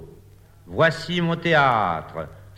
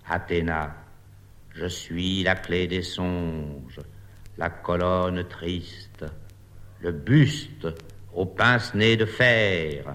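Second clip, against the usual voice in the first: low solemn statue voice; 6 semitones lower, 4 dB quieter than usual. Acted. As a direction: speak low like a stone goddess. Athena bust speaks again.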